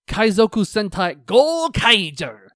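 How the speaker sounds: loud, drawn-out hero show title call; announcer-style title shout. Pretend. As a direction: belt out the title like a series announcer.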